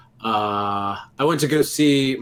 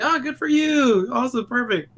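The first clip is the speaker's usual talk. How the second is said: high chipper voice; 10 semitones higher, 3 dB louder than usual. Retold or imitated. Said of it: "I was like".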